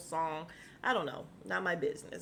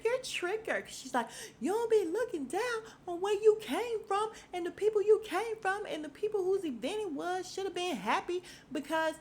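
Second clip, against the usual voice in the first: imitating her in a high put-on voice; hammed-up high voice of a reality star ranting; high mock voice; 10 semitones higher than usual. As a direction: pitch it way up to mock her rant. The girl quoted arguing.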